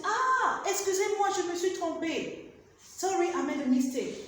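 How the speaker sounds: acted apology. Shown as a demonstration.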